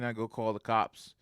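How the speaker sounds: ordinary talk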